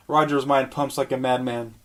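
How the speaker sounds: normal talking voice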